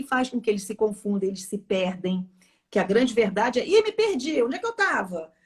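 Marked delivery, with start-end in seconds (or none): 3.7-5.3 high, loud plea of a flustered pupil; hammed-up, high-pitched classroom protest; mock pupil panic. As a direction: play a kid begging the teacher to wait. Acting out pupils at the blackboard.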